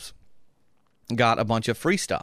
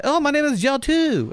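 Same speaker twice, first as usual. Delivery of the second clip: high surprised voice; 13 semitones higher than usual. Retold or imitated. Stranger replies in sauna.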